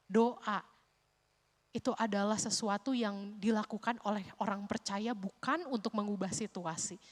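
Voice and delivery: ordinary delivery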